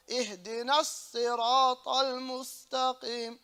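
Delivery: "اهدنا الصراط المستقيم" recited with a sustained s; deliberate "اهدنا الصراط المستقيم", long doubled sad; held sad. Shown as a demonstration. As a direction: press and lengthen the s in "الصراط". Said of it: "So you hold the sad".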